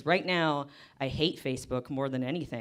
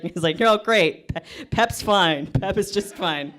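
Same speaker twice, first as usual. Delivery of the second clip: louder, slowing on the doctor's lines; mock doctor rant; 9 dB louder than usual. Comic to audience imagining a creepy doctor, loud mock telling.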